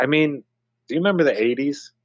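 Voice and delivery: ordinary delivery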